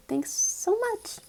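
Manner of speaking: high, drawn-out fake gratitude; sarcastic thanks. Storyteller thanks her uncaring relatives, sarcastic.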